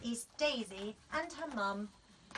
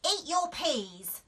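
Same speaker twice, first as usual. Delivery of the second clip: firm, slow, raised mum voice; mum's order; 6 semitones higher, 6 dB louder, slower than usual. A narrator's reading. Mum telling Daisy at dinner, slow and firm. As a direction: drag out the order like a tired mother. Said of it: "said Mum".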